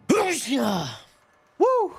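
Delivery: high, explosive sneeze with a whoop after; big sneeze. Real, not acted. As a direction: let out a huge real sneeze. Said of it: "That was a sneeze and a half".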